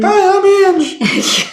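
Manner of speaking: very high, squeaky put-on voice; Ange impression. Co-host mimicking an absent friend, mock high-pitched voice. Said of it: "Did you know you sounded like that".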